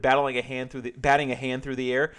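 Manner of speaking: ordinary talk, nothing marked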